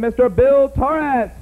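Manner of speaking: usual speaking voice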